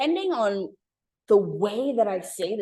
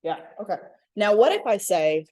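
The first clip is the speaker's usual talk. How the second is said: usual speaking voice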